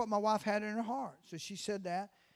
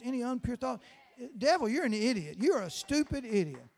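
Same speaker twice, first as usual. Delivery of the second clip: loud fervent prayer at full force; preaching prayer; 5 dB louder than usual. Real, not acted. Preacher praying over the small group, fervent and loud. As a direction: pray it out with fire.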